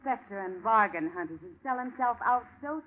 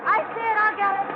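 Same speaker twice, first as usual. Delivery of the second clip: loud, much higher shouted reply; calling back; 11 semitones higher, 8 dB louder than usual. Acted. Partner shouts back to the detective over the water, loud. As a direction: shout it across the soaked roof.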